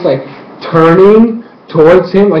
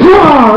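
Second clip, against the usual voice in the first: high mock scream; hammed-up girl's scream; 11 semitones higher, 5 dB louder than usual. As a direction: shriek it out, higher and loud. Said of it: "this is what she's like".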